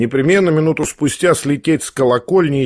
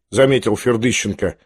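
usual speaking voice

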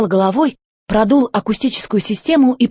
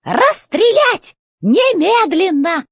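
high, slow, commanding robot boss; robot chef voice; 10 semitones higher, much slower than usual. A narrator's reading. Robot chef demanding answers from the old robot, high and commanding. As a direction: bark orders from up high like a tin general.

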